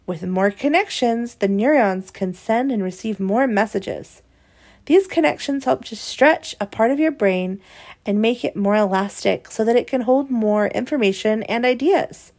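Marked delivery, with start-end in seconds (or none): none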